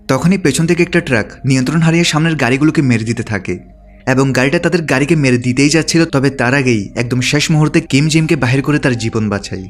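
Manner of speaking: plain reading voice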